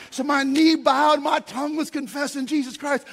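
a barber reenacting his vision at full volume; fired-up preacher. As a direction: belt it out like a man testifying. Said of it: "He goes".

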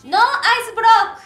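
loud, high proud announcement; excited announcement. Real, not acted. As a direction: read it out like a headline. Reading her card aloud.

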